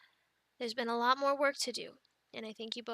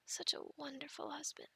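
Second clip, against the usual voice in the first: soft, whispered sarcasm after a sigh; sarcastic whisper; 10 dB quieter than usual. Real, not acted. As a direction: breathe it out with dry irony. Sarcastic aside about husband.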